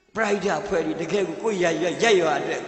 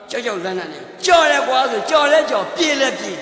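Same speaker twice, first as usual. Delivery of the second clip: loud, urgent call to dare and run; urgent shout; 5 semitones higher, 6 dB louder than usual. Retold or imitated. Speaker retelling a scared man's dash, urgent shout. Frightened man dashing past, urged on.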